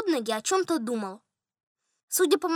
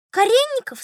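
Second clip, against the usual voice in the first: higher, louder questioning voice; general's wife asking; 9 semitones higher, 4 dB louder than usual. A narrator's reading. General's wife questioning the steward, higher voice. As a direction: put the question in a raised lady's pitch. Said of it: "спросила генеральша".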